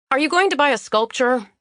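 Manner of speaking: impatient demand to a time-waster. Acted. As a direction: press her for an answer. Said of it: "I demanded".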